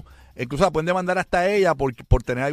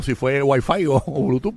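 ordinary delivery

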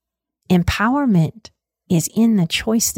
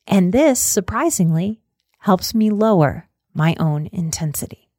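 spoken affirmations; 3 semitones higher than usual. Acted. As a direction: say each affirmation as a gentle self-promise. Affirmation reframe.

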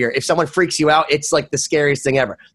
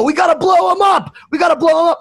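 high-pitched frantic party chant; raised, over-excited crowd voice; mock rallying cry; 12 semitones higher, 4 dB louder than usual. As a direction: imitate hyped-up ravers urgently rallying. Partygoers rally around tripping teller.

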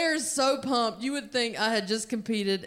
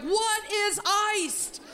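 confused; 11 semitones higher, much slower than usual. Retold or imitated. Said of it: "I'm like".

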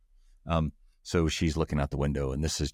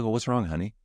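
caring partner; 6 semitones higher than usual. Retold or imitated. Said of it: "you're like".

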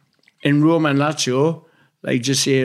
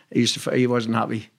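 ordinary talk

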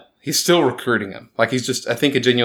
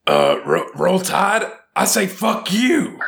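gargling-nails football coach impression; rough, strained coach voice pushed up high; 7 semitones higher, much slower than usual. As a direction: rasp it out like a coach firing up the team. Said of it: "should I try to do a Coach O voice?".

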